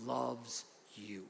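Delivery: soft and intimate, each word slow; hushed tender. Real, not acted. Pastor softly telling the congregation God loves them. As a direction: drop right down and say it softly. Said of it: "I want you to know this".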